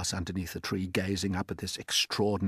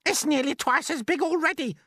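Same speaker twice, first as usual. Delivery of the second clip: a far higher, louder aunt's shout; Aunt Spiker shouting; 5 dB louder than usual. Acted. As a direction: screech it out as the nasty aunt. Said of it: "Aunt Spiker shouted".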